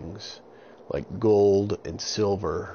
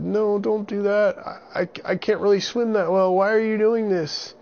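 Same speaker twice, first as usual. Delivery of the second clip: put-on very high, loud frightened boy; scared boy voice; 13 semitones higher, 5 dB louder than usual. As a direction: plead like a panicked little boy. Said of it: "And they said".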